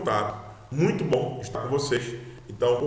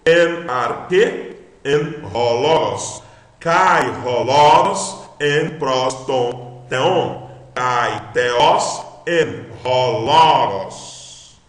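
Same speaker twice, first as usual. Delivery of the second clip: slow, loud word-by-word Greek reading; read-along recitation; 5 dB louder, much slower than usual. Shown as a demonstration. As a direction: recite slowly and firmly for students to follow. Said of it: "Vamos ler tudo junto?".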